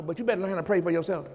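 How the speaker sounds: rushed, run-together words; quick aside. Real, not acted. Preacher advising those who have his number, quick aside. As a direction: rattle off "you better learn how to pray for yourself" fast. Quick first take before emphatic repeat.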